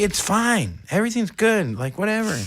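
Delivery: mock casual reassurance; forced calm. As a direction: talk yourself down, lightly. Self reassurance before doctor.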